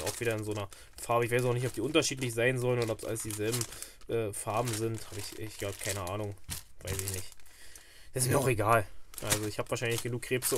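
ordinary delivery

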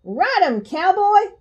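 high-pitched story-time cheer; Elmo cheering. A narrator's reading. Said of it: "So I cheered".

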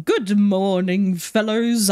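slow, drawling hello with a lift on the last word; odd drawn-out greeting. Pretend. Greeting the ambulance drivers, oddly drawn out. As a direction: stretch the greeting out in a silly way. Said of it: "I don't know why I'm speaking like that".

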